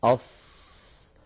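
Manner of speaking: very slow, breathy أص; trapped sad sound; pressed sad demo. Shown as a demonstration. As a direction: hold أص long and squeezed. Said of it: "ينحصر الصوت في هذا المكان".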